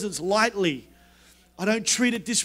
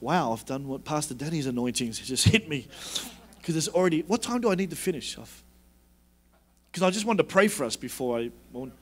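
usual speaking voice

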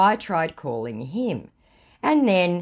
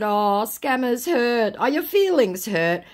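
high, drawn-out teasing; exaggerated pitying taunt; mock sympathy; 7 semitones higher than usual. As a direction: coo it tauntingly, stretching the first words. Teases the scammer, pretending sympathy.

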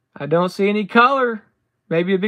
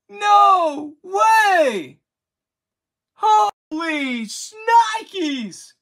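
ecstatic shouting; 7 semitones higher, slower than usual. Real, not acted. Pulling a card he never got before, ecstatic shouting. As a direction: scream it in wild disbelief. Pulls a rare relic.

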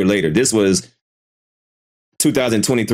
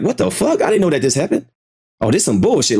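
high-pitched outrage, settling by the end; imagined outrage; 6 semitones higher than usual. Acted. Man imagining himself as the wronged woman, indignant. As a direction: act incredulous, starting high. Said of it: "I'm gonna be pissed the fuck off".

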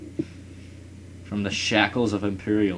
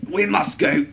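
higher, louder hint of a Russian accent; hammed-up Russian accent; 8 semitones higher, 6 dB louder than usual. Voicing Russia's AI in a half-hearted Russian accent. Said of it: "I don't feel like doing a Russian accent".

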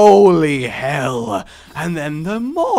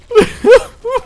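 laughing at himself; 10 dB louder than usual. Real, not acted. Laughing at own bit.

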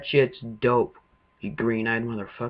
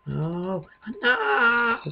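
drawn-out, high-pitched "no"; alarmed cry; 11 semitones higher than usual. Real, not acted. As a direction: cry out as disaster strikes, pitch leaping up. Monsters get into the house.

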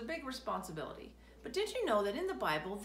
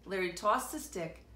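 ordinary delivery

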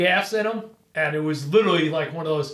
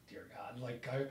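hushed prayer; 5 semitones lower, 11 dB quieter than usual. Retold or imitated. Praying the bait plan works.

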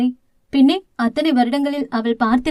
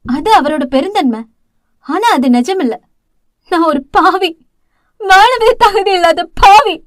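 bursting into wailing; 4 semitones higher, 6 dB louder than usual. Acted. Elder sister condemns herself to her sister, building to a loud wail. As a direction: let it break into a howl of grief by the end. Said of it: "தளிர் வீறிட்டு அழ".

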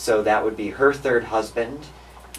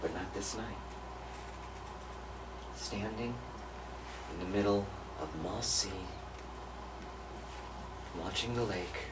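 very soft, with long pauses; hushed storytelling; 16 dB quieter than usual. Real, not acted. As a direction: let each phrase hang quietly.

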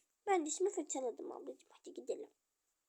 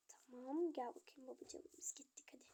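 soft unvoiced whisper; 4 semitones lower, 15 dB quieter than usual. Father calling the little one to come, whispered. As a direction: hush it to the child. Quiet aside in doll play.

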